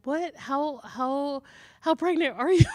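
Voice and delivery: high, loud stammering disbelief; shocked question. Retold or imitated. Midwife asking the walk-in client, stunned and loud. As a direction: stumble over it in amazement. Quoting own shocked reaction.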